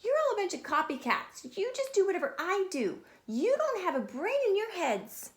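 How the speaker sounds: high exasperated scolding; scolding girl. Acted. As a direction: scold the copycats. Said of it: "looked at them and said".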